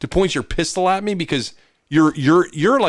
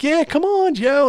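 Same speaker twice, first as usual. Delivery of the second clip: much higher, cheery invitation; mock eager roommates, pitched way up; eager welcome; 3 dB louder, slower than usual. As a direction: welcome him in with open arms.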